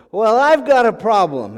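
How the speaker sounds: slow, loud admission of trouble; plaintive complaint. Acted. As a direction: blurt out the trouble, drawing it out. King blurts trouble to Jesus.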